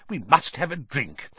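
high, eager excitement, rising to a cry; delighted. A narrator's reading. Mr Weasley marvelling at Muggle money, delighted. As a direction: gush with mounting excitement. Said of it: "said Mister Weasley delightedly".

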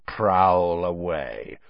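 low, slow, drawn-out menace; Moody. A narrator's reading. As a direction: invite him with veiled threat, pitched low. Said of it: "his voice was full of menace".

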